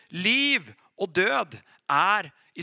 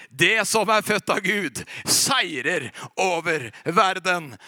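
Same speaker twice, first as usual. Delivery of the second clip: loud, slow declaration of victory; booming proclamation of faith; triumphant proclamation; 4 dB louder, slower than usual. Retold or imitated. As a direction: proclaim the verse loudly and slowly. Faith voice wins at the end.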